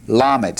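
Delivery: "Lamed" said as LAH-med, stress on the first syllable, falling; teacher modelling "Lamed"; pronouncing lamed. Shown as a demonstration. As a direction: model "Lamed" clearly for the class to repeat. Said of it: "Say that with me".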